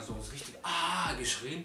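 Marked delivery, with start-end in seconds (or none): 0.6-1.1 short loud "ah" cry; imitated scream. Storyteller showing how he screamed when kicked, imitated scream. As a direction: yell out as if hurt, briefly. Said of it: "da hab ich richtig laut geschrien".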